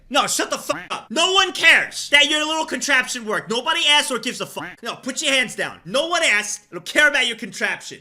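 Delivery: mock dismissive rant. Mocking chat's contraption.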